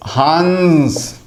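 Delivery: हंस drawn out very slowly, nasal coming after the vowel; anusvara nasal after vowel. Shown as a demonstration. Teacher slowly sounding out हंस for students. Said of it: "जहाँ भी आप देखेंगे".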